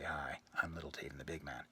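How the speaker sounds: breathy unvoiced whisper of his own name. Whispering his name into the woman's ear. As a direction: lean in and whisper the intro.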